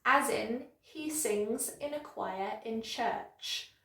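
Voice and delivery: usual speaking voice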